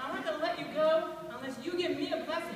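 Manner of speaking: forceful, rapid acted defiance; stubborn refusal. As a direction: dig in and refuse mid-struggle. Jacob refuses while still grappling.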